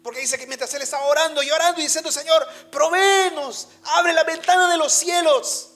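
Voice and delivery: loud, high, impassioned sermon delivery; full-force preaching. Acted. Preacher to his church, loud and impassioned. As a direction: preach it out to the whole congregation.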